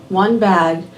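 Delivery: ordinary delivery, nothing marked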